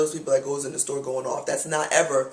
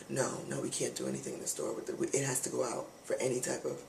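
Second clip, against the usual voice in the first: mumbled mock clerk; 4 semitones lower, 12 dB quieter than usual. Mimicking the dismissive clerk.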